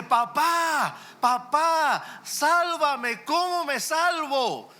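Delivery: put-on high, loud, frightened child's cry; a trapped boy calling out to his father, pleading; high pleading call of a child caught in a fire; child screaming for dad. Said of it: "empieza a gritarle a su papá".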